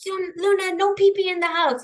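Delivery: high, loud mock scold; scolding the dog. Owner scolding Luna for peeing indoors, high and loud. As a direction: scold the puppy in a high yelp. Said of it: "I'll yell at Luna".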